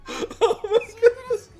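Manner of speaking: laughing helplessly at a chat joke while apologising; laughing hard. Real, not acted. Streamer cracking up at her mod's joke, laughing through the words. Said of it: "shouldn't be laughing like that".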